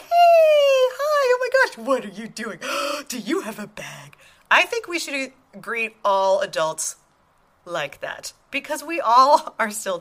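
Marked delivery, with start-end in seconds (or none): 0.0-4.2 high, loud squeal easing into a coo; gushing at a child. Acted. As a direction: greet a little kid with gasping delight. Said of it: "when you see a child out in the world".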